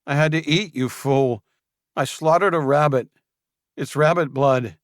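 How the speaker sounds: high, loud contemptuous answer; Julian scornful. Acted. Julian scorning Rodriguez, high and loud. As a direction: sneer at the fool. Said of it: "Julian snorted".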